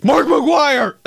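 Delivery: high, loud cheer of the name; hyped shout. Real, not acted. Comedian hyping his favorite baseball player with a shout. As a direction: yell it like a fan in the stands.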